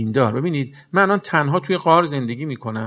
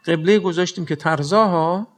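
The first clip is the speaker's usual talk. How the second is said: ordinary talk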